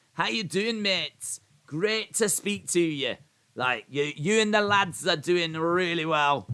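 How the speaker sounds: put-on high-pitched go at a Scottish accent; Ali McCoist. Giving Ali's message to Bellingham a go, imitated Scottish accent. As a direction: imitate a Scottish pundit greeting a player. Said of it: "I'll give it a go".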